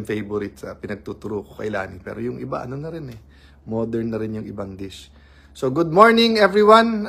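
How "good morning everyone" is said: high and loud good morning to the viewers; bright loud greeting. Real, not acted. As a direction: throw the greeting out to everyone watching. (malakas at masiglang pagbati ng magandang umaga)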